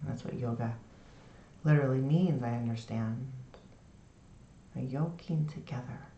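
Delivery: soft, low, soothing meditation voice; guided meditation. Shown as a demonstration. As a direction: ease them into the body with long pauses. Leads a lying-down meditation.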